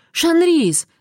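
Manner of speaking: high, indignant exclamation of a name; indignant cry. A narrator's reading. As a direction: call out the name in outrage. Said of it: "возмутилась Амберли".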